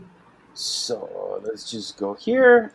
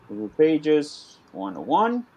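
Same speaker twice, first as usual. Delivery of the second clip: slow, spelled-out dictation while typing; 3 semitones higher, much slower than usual. Shown as a demonstration. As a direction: read each word slowly as you type it. Repeats title while typing it.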